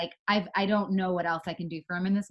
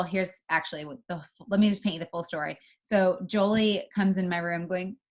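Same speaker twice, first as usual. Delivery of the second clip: ordinary delivery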